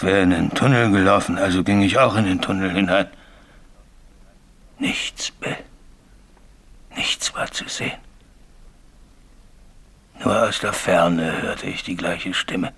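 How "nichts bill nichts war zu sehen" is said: breathless hush, barely voiced; hushed whisper. Signalman whispers to Bill about the empty tunnel, hushed. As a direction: whisper it, reliving the dark tunnel. Ghost story told in hushed voice.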